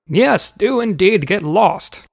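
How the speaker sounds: high, slow hammed-up yell; servant yelling. A narrator's reading. Servant applauding the departing uncle, mild high yell. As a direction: call it out in a high, drawn-out voice. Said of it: "yelled a servant".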